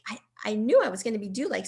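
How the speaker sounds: normal talking voice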